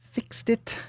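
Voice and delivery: tiny high-pitched boast; silly high voice. Pretend. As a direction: pipe it up high, playfully.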